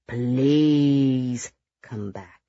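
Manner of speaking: long, imploring please; very slow, pleading request; drawn-out plea. A narrator's reading. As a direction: beg slowly, stretching the please. Guest begs Michael to return.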